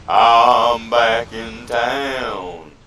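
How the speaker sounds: loud, very slow proclamation; slow loud announcement. Pretend. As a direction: announce it slowly and grandly. Respawns, announces return.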